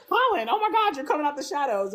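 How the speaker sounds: high, startled cry of his name; mock-shocked Penelope voice, high. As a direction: act caught off guard by him. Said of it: "and she was like".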